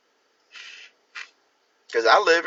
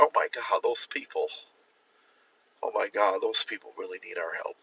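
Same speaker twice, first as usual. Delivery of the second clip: mock pity; 5 semitones lower, 9 dB quieter than usual. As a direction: fake a pitying hush over poor souls.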